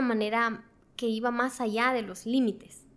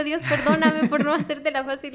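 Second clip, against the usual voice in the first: louder, higher mock plea to God; disculpa entre risas; 4 semitones higher, 7 dB louder than usual. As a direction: beg forgiveness while amused at yourself. Se disculpa con Dios riéndose. (súplica en broma, entre risas)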